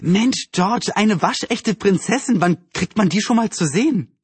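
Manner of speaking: put-on high, thrilled girlish voice; bubbling excitement. A narrator's reading. Anne urges George about seeing a real princess, excited. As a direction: gush as if bouncing up and down. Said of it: "Anne hüpfte wie ein Flummi".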